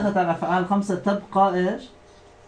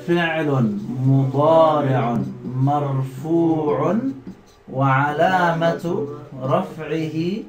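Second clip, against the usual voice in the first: slow, measured phrase by phrase; teacher dictating grammar analysis slowly; slow dictation; much slower than usual. Shown as a demonstration. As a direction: dictate the parsing for students to write. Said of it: "يلا نعربه معا".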